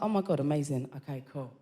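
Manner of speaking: low, hushed aside to self; private relief. Retold or imitated. Talking to herself once the evidence melts, private relief. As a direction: drop right down, as if thinking aloud.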